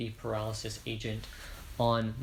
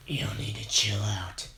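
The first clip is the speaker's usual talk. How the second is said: whispered try at a deep voice, pitched lower; deep voice attempt; 4 semitones lower than usual. Hero tells Muscular to chill, lower whispered deep voice. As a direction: growl it low and breathy, falling short. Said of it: "No, I can't do it".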